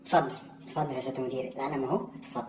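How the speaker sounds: quick, soft submissive; meek. Acted. Employee handing the boss the pen, quick and soft. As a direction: hand it over timidly and fast.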